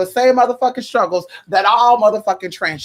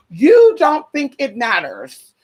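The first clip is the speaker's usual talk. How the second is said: slowed, louder, each word weighted; slow emphatic; 3 semitones higher, 4 dB louder, much slower than usual. Real, not acted. Lecturing the looters' parents, slow and emphatic. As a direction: drive the point home slowly. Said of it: "repeated for emphasis".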